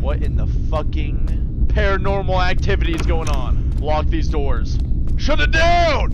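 loud, frantic shouting; panicked yelling. Real, not acted. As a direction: yell at the screen in a rising panic.

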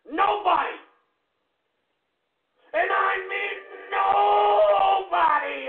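high, shouted fury; enraged high-pitched yelling; raging threat. Real, not acted. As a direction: bellow the threat, stretching the last NOBODY. Raging threats at absent man.

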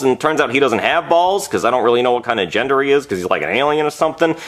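usual speaking voice

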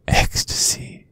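unvoiced whispered word. Villain whispering to the captive priest. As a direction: breathe it out seductively.

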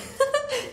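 short high giggle; amused laugh. Acted. Wife reading a silly wedding card, amused giggle. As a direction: burst into a quick, light laugh.

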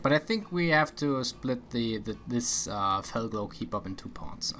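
ordinary talk

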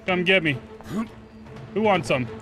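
usual speaking voice

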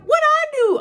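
very high, loud squawk; indignant protest. Retold or imitated. Kicked-out student to teacher, high indignant yelp. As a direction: shoot way up in loud mock innocence.

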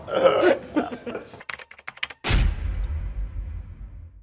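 long, high, breathy laughter; real laugh. Real, not acted. Teased by a colleague, laughing helplessly. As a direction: keep cracking up, running out of voice. Laughs on after calling him asshole.

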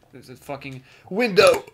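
rising to a loud "window"; exasperated outburst. Real, not acted. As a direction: burst out in frustration at the game. Frustrated at blocked path in game.

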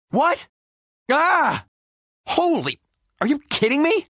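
high-pitched startled outburst; shocked disbelief. Acted. Pilot reacting to trouble in flight, shocked. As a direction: cry out in alarmed disbelief.

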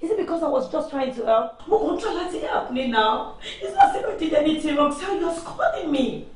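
crying complaint, slowing at the end; tearful protest. Acted. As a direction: whine as if being unfairly scolded. Wife tearfully protests being scolded.

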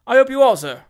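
loud, emphatic sanctimony; forceful, haughty legal gentleman; pompous lawyer Dodson. A narrator's reading. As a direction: lecture the client's opponent with lofty self-importance.

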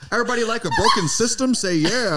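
high, laughing, preachy host voice; mock-rallying American emcee. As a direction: hype the crowd like a southern preacher host. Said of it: "mock host continues".

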